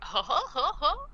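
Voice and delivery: high, put-on "ho ho" laugh; mock laughter. Staff reacting to the tornado joke, mock laughter.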